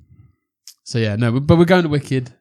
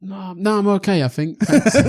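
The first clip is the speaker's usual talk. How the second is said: much higher, giggly no-thanks; awkward laughing refusal; 8 semitones higher than usual. Retold or imitated. Turning down Lucy's Wicked invite, laughing awkwardly. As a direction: decline politely while stifling a laugh.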